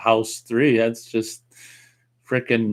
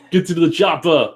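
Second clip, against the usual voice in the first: put-on punchy, raised Austrian action-hero accent; higher-pitched action-star impersonation; Arnold Schwarzenegger; 6 semitones higher, 4 dB louder than usual. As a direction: do a big movie-commando catchphrase. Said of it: "Give us an Arnold impersonation".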